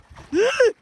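loud shocked gasp; sharp gasp. Real, not acted. Woman in the water gasps in shock. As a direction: gasp at something touching you. Startled again.

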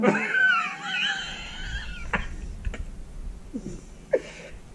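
long, very high squealing laugh; helpless laughter. Real, not acted. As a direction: crack up in a drawn-out squeal. Host laughing at own joke.

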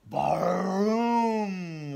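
slow, loud, drawn-out boom; a storyteller's big thunder boom. A narrator's reading. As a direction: let the thunder boom out slowly. Narrator booms thunder sound.

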